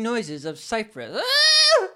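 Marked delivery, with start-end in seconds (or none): 1.2-1.9 sudden high-pitched shriek; child's scream. Acted. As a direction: yelp like a scared kid. Cypress cries out in net.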